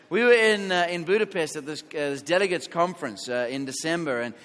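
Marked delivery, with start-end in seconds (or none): none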